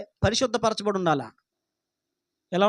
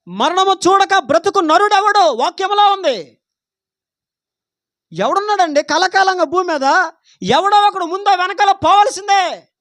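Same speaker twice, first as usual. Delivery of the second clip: louder, higher fervent preaching; preaching at full force; 6 semitones higher, 7 dB louder than usual. Real, not acted. Preacher warning the church about death, loud and fervent. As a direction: drive the point home, loud.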